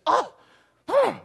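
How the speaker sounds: sharp, high huffy hmm; with an attitude. Shown as a demonstration. Preacher showing Mary answering with attitude, a high huffy hmm. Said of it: "I don't think she said that with an attitude".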